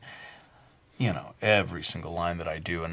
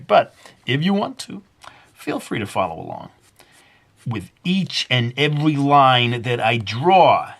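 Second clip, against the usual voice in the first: put-on Brooklyn accent; 4 semitones higher, 6 dB louder than usual. Vlogger drawing for viewers, in a joking Brooklyn accent. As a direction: lay on a mock Brooklyn accent.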